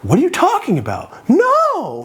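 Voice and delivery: squeaking up in denial; voice going high. Retold or imitated. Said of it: "His voice got all high".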